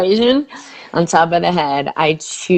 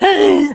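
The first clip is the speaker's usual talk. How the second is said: alarmed gasp; 11 semitones higher than usual. Retold or imitated. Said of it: "he used to really feel like".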